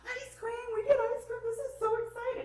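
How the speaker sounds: high-pitched, gleeful squeal of words; excited child. Acted. Child announcing ice cream to mom, excited. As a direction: play a little kid thrilled about a treat. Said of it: "they're excited about something".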